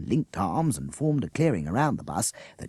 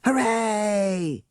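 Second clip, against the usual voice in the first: high, loud long cheer; the crowd roars its approval; 13 semitones higher, 4 dB louder than usual. A narrator's reading. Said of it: "screamed the crowd".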